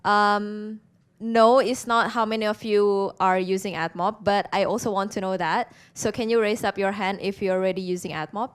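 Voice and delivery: ordinary talk, nothing marked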